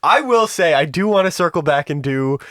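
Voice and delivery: ordinary talk, nothing marked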